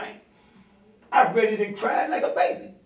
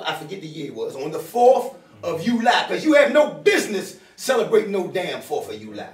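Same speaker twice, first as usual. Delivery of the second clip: ordinary talk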